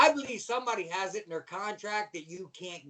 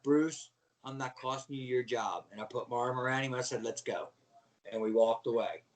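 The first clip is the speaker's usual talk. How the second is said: subdued, tearful resignation; 7 semitones lower than usual. Retold or imitated. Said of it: "I was crying".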